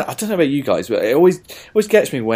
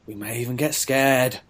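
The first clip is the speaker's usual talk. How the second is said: usual speaking voice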